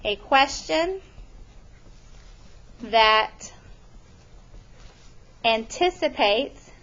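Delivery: word by word with long pauses; feed the definition slowly for writing; dictating a definition; slow dictation. Shown as a demonstration. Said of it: "A statistical question".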